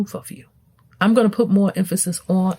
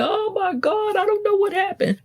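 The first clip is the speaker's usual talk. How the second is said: much higher, mock-panicked child's voice; panicked kid; 13 semitones higher than usual. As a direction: play a flustered kid who has no clue. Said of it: "your kids shouldn't be coming home saying".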